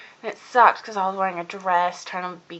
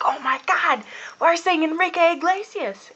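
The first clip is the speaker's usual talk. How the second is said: high, excited fan reaction; acted-out fangirl amazement; excited disbelief; 9 semitones higher than usual. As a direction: gush it in a raised pitch. Acting out her excited reaction.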